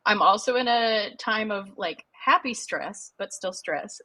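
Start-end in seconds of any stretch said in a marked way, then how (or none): none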